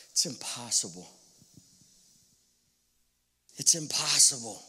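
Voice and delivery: low, soft, hopeless; defeated. Pretend. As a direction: sigh it out like giving up. Spies' despairing report.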